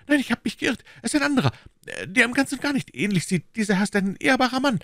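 high-pitched, pleading backpedal; frantic retraction. Acted. Mercer swearing he mistook the man, frantically pleading.

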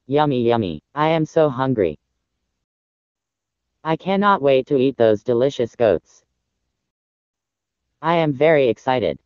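a low, gloating wolf thinking of dinner; wolf gloating low to himself; hungry wolf. Acted.

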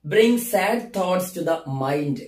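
very slow, deliberate recital; slow poetry reading. Shown as a demonstration. Teacher reading the fourth line slowly to students. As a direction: draw out each word. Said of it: "ഇനി നാലാമത്തെ വരി നോക്കൂ".

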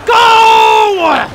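long, high, loud yell; all-out battle cry; urgent shout. Real, not acted. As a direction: bellow it at the game, drawn out. Yelling at the game in a fight.

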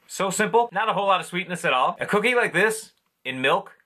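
speaking between bites; talking while chewing. Real, not acted. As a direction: mouth partly full of cookie. Seen 0: bites at -2 and 3.9; closed-mouth chewing at 4.4-5.0.

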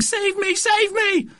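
very high, squealing plea; panicked cry. A narrator's reading. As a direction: squeal for rescue in helpless terror.

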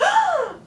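high, sharp mock gasp; acted gasp of surprise; shocked gasp. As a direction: gasp as an astonished crowd. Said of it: "shocked and surprised".

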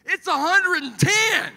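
very high, drawn-out shout; hammed-up, high-pitched incredulous bellow; exasperated yell. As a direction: holler it slowly in comic outrage. Exasperated retort to the cliché.

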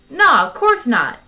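loud, drawn-out, high breezy denial. A narrator's reading. Zaphod denies visiting the planet to Ford, breezy. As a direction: wave it away breezily, slowly and loudly. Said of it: "said Zaphod breezily".

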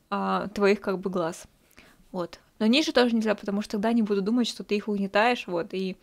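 ordinary talk

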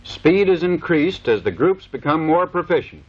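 usual speaking voice

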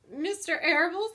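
high, loud wail of dismay; sobbed question. A narrator's reading. Pig hears who is in on the plot, sobbing. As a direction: wail it like a distraught piglet. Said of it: "sobbed Wilbur".

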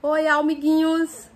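high, loud, slow cheery greeting; kids show host. Pretend. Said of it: "Dá oi pro pessoal do canal". (voz de apresentadora infantil)

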